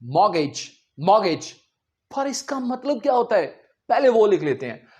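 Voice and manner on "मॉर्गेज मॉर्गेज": मॉर्गेज said mor-gej, no t; correct pronunciation. Shown as a demonstration. Teacher drills students: मॉर्गेज without t. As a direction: repeat मॉर्गेज with silent t. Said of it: "मॉर्टगेज नहीं कहना".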